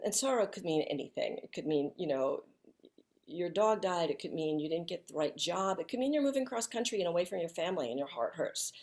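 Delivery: ordinary talk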